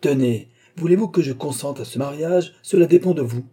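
high, loud, angry challenge; enraged. A narrator's reading. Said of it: "ivre de rage".

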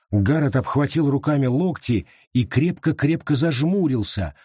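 plain reading voice

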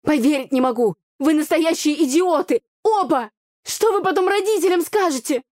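loud scolding. A narrator's reading. Heroine scolds both brothers, loud and exasperated. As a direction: tear into them both. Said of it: "громко констатировала я".

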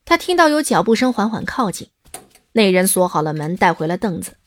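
usual speaking voice

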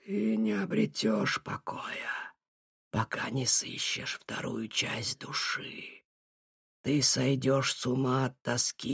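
slow, eerie whispering prophet; sinister old seer. A narrator's reading. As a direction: whisper the doom slowly.

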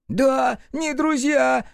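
high shrieking imp voice, shouting; Пепелюха yelling. A narrator's reading. Imp yells back at Рух in a shrill shriek. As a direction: screech it out like a small angry imp. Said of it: "завопил Пепелюха".

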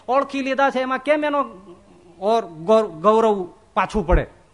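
normal talking voice

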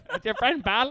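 high squeaky voice. Pretend. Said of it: "got her into a different octave".

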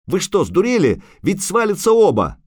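high, outraged shout; frantic, scolding cry. A narrator's reading. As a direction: yell at them in alarm. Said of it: "кричал Лютик".

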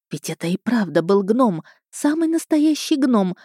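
usual speaking voice

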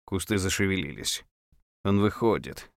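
ordinary delivery, nothing marked

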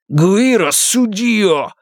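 high, slow, raging oath; furious vow. A narrator's reading. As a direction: swear it to the sky in fury. Mario swears revenge.

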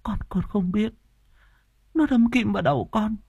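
high, small frightened voice; thin, very high fearful plea; cowering fear. A narrator's reading. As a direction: stammer it out, shrinking away in terror. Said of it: "cả người co rúm".

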